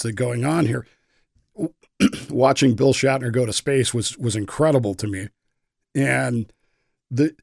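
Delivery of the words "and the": hoarse, losing voice. Real, not acted. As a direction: voice giving out, slow and strained. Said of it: "Sorry, I'm losing my voice here".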